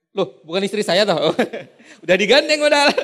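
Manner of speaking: surprised, then laughing loudly; mock surprise. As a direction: jokingly realize it's the wrong wife. Husband realizing wrong wife. (kaget pura-pura sambil tertawa)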